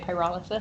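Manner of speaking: softly said pyrolysis, stress on RAH; pyrolysis as pie-RAH-luh-sis. Shown as a demonstration. Committee member confirming own way, pyrolysis as pie-RAH-luh-sis. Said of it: "That's how I say it".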